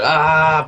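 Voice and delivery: high, loud "ahh" cut short; charging yell. Acted. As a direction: yell as you slam into a door. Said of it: "you go".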